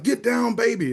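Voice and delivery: high-pitched mock bird cry; parakeet yelling. Parakeet to the owner's dancing friends, high mock yell. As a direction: pitch it way up for a pretend parrot call. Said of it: "the parakeet yells out".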